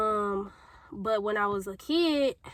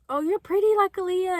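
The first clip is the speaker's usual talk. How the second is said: imitated compliment, much higher and a bit brighter in tone; gushing admirer; 8 semitones higher, 4 dB louder than usual. Admirers praising a young girl, mock high gush. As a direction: play the gushing grown-up, voice lifted high. People complimenting her as a kid.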